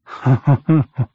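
a loud burst of laughter; hearty laugh at own joke. Acted. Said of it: "She laughed at her own joke".

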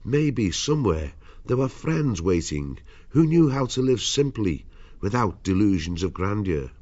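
usual speaking voice

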